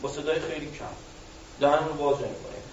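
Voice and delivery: quiet, mouth barely open; mumbled low voice. Shown as a demonstration. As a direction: mumble it softly without opening your mouth.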